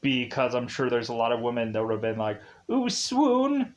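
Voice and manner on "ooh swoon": high, drawn-out swoon; mock swooning. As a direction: imitate women swooning over an accent. Imitating women swooning over accents.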